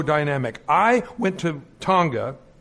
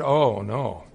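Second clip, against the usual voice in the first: low, sinking reaction; mock dread, pitched down; retold dismay; 8 semitones lower than usual. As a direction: re-enact a heart-sinking realization. Dismayed at dog on plate.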